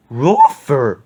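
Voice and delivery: loud gruff dog call; cartoon dog shout; Scooby cry. A narrator's reading. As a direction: bark it out while chasing. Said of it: "cried Scooby".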